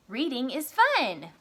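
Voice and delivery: slow, enthusiastic example sentence; excited exclamation. Shown as a demonstration. Teacher offering the next exclamation sentence to pupils, excited. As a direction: stretch it out with lively pitch swoops.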